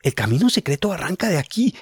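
thinking it through with excitement; Julián thinking. Acted. Julián's thoughts, discovery.

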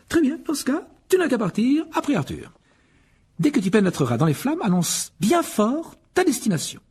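a brisk mother of many sons; Mrs Weasley. Acted. Molly voiced. (voix de mère plus aiguë)

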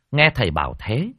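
ordinary delivery

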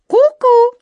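very high, loud cuckoo call; proud cuckoo. Acted. Cuckoo confirming the gold is magical, proudly. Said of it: "с гордостью подтвердила кукушка".

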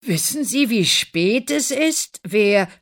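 grumpy annoyance. Acted. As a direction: scold the late visitor. Father woken at night, annoyed.

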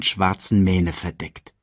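ordinary delivery, nothing marked

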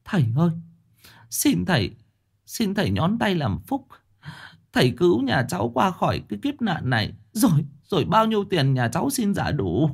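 wife begging the master for help. A narrator's reading. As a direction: beg on your knees. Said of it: "quỳ xuống mà van lạy".

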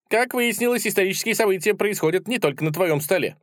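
high, perky character voice; pert little figure. A narrator's reading. Little visitor reports world news, perky high voice. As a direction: keep it pitched high and chatty. Tik speaks to narrator.

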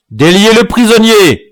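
very high, loud order; shouted command. A narrator's reading. Chief ordering the prisoner freed, shouted command. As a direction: shout to override the rival. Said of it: "cria Buc-Jargal".

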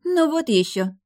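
high-pitched scoff; dismissive snort. A narrator's reading. Girl refusing a boy's seat offer, snorting disdain. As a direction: snort it off girlishly. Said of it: "фыркнула она".

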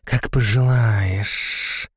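very slow snake-woman hiss; relishing hiss. A narrator's reading. Naga accepting her master's order, hissing with relish. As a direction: savour it, hissing out the last sound. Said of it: "с наслаждением прошипела она".

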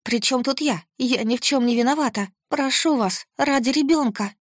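high, breaking desperate plea; tearful pleading. A narrator's reading. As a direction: beg through rising sobs. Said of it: "Рыдания взяли верх".

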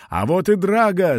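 put-on boy calling out that Drago has come; shouting. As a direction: shout out the good news. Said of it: "закричал Вико".

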